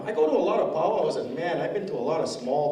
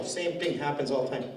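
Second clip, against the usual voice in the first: ordinary delivery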